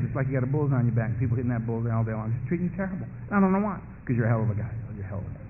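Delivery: low, soft tempter's voice; hushed, low, coaxing voice of addiction; whispering beast. Acted. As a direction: murmur sympathetically into his ear. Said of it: "the beast whisper up and say".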